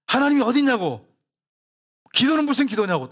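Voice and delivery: retold outburst, pitched high; defiant beggar. Beggar interrupting the pastor's grace, mock defiant outburst. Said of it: "갑자기 거지가 뭐라 그러냐면은".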